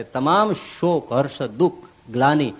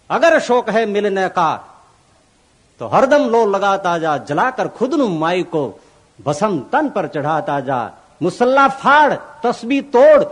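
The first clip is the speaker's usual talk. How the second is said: impassioned poem recitation; 3 semitones higher, 5 dB louder than usual. Acted. Recites Mansur's Sufi poem. (જોશભર્યું કાવ્યપઠન)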